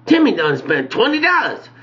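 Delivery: high, indignant outburst; shocked at price. Retold or imitated.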